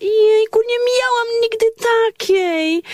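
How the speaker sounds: high, gushing over a present; gushing delight. Acted. Woman unwrapping Christmas pyjamas, gushing high delight. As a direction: squeal with delight at the gift.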